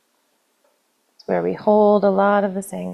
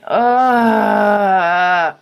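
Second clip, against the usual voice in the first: a loud, raw groan of disgust; frustrated shout; 6 dB louder than usual. Acted. Tapping leader venting resentment, loud frustrated ugh. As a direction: let it burst out in one loud ugh.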